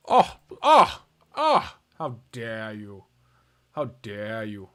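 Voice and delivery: low, drawn-out fake indignation; mock offended. Mock outrage at a rival player's move. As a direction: ham up being insulted, slowly.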